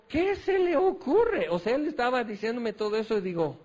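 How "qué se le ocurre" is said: high, slow, stunned disbelief; as if you can't believe what he just proposed; incredulous. Retold or imitated. Preacher retells his reaction to his friend, slow high disbelief.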